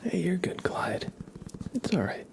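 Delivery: soft unvoiced whisper, gentle; calm him down softly, then greet; reassuring whisper. Real, not acted. Streamer reassuring Clyde it was a joke, whispering.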